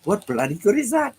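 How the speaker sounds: playful Australian accent impression; imitating a bad Australian accent. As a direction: mimic a film star's clumsy Australian accent. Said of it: "trying to imitate an Australian voice".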